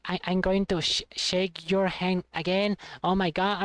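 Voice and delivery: ordinary delivery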